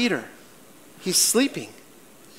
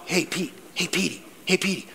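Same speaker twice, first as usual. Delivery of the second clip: soft coaxing angel, softer; gentle nudging call; 6 dB quieter than usual. Retold or imitated. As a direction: tiptoe the name to wake a sleeper. Angel gently waking Peter.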